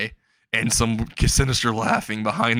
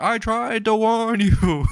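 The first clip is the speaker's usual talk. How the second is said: high, drawn-out mock yell; imitating someone screaming from far below; 11 semitones higher, 4 dB louder, slower than usual. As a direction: call it out slowly in a high strained-for-effect holler. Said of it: "He's screaming from the ground".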